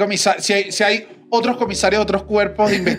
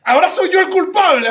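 high-pitched, indignant protest; mock indignation; 12 semitones higher, 4 dB louder than usual. Retelling protest to the investigating officer, mock indignant. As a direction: act out being wrongly accused, pitch way up. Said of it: "yo estaba indignado".

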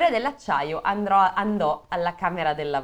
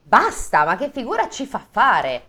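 indignant scolding; 5 semitones higher, 6 dB louder than usual. Acted. Indignant line in read story.

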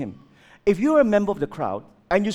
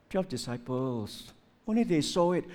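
ordinary talk, nothing marked

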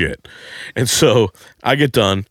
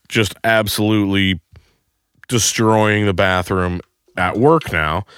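normal talking voice

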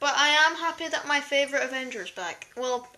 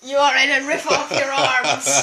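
booming god voice, loud and menacing; megalomaniac rant; 5 dB louder than usual. Retold or imitated. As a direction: rant like an angry god threatening. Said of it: "then it's just one guy".

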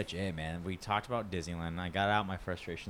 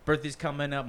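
usual speaking voice

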